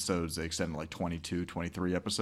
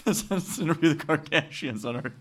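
mock podcaster voice; 8 semitones higher than usual. Host parodies a YouTuber's podcast pitch, higher mock voice. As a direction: ham it up as the smug podcaster inviting the Kardashians. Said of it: "It's like".

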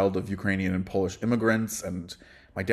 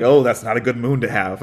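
louder, mock-disapproving verdict; mock judgment; 3 semitones higher, 9 dB louder than usual. Teasing two astrologer friends about indulging in judgment, mock-disapproving. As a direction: imitate friends passing astrological judgment, loudly. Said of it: "You'd be like".

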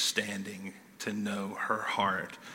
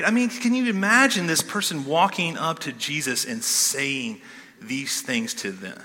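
usual speaking voice

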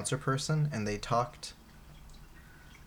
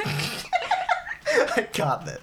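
squeaky high laugh through the words; cracking up while speaking; much slower than usual. Real, not acted. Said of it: "laughing mid-accent".

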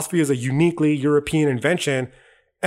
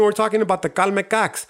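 usual speaking voice

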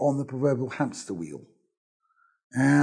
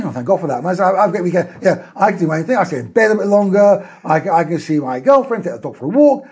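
cheerful; 3 semitones higher, 7 dB louder than usual. Pretend. Voicing the glad stay-at-home.

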